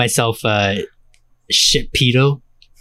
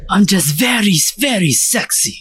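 higher, sultry fake Spanish accent; 9 semitones higher than usual. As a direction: play the suave cat in a Spanish accent. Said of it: "This guy's faking his accent".

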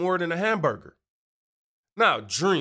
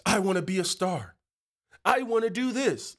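higher-pitched imitation of a starry-eyed wannabe; mock selfish dreamer; 5 semitones higher than usual. Podcaster imitating people selfish with their dreams, mock wannabe voice. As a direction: mimic someone set on their own wants.

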